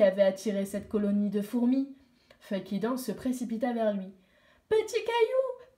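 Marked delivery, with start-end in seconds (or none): 4.7-5.8 first call, much higher and slow. Acted. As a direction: call his name across the rocks, high. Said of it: "cria-t-elle".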